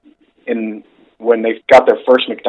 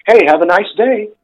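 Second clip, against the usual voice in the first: chirpy American greeting; 9 semitones higher than usual. Retold or imitated. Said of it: "telling our fellow Americans".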